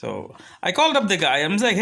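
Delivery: ordinary delivery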